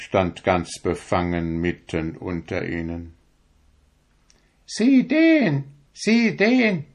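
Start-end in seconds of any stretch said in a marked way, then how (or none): 4.7-6.8 very high, squawky hen voices; high hen chorus jeering at the stork; clucking hens. A narrator's reading. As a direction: cackle it like a flock of gossiping hens. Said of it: "sagten alle Hühner".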